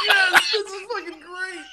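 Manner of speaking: high, loud cheer over the movie; laughing, high-pitched yell of approval; delighted shout. Retold or imitated. Fan reacting to a great movie line, delighted shout.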